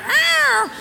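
very high, loud cartoon animal roar; dog roar. Angry dog lunging at the cat, hammed-up roar.

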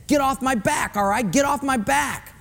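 high, sharp, annoyed snap; irritated get off my back. Retold or imitated. Husband snapping at his nagging wife, high and annoyed. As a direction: push them away, annoyed. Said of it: "a religious way of saying".

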